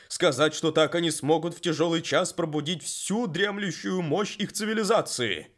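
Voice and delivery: loud, high, grave explanation; talking horse explains darkly. Acted. (голос коня, мрачно)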